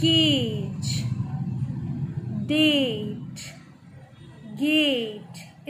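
केज, डेट, गेट with the A stretched long; long vowel. Shown as a demonstration. Teacher showing pupils the long A, vowel held in केज, डेट, गेट. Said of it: "लंबा खींच रहे हैं थोड़ा".

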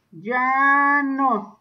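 "llanos" slowly, in two long syllables; drawn-out repeat. Shown as a demonstration. Teacher dictating a term to pupils, "llanos" drawn out. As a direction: stretch the first syllable of "llanos".

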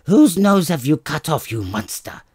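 loud angry cry at her husband; screaming wife. Acted. Wife scolding her barber husband, loud and angry. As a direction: scream it at him in disgust. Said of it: "she screamed".

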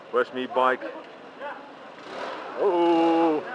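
ordinary delivery

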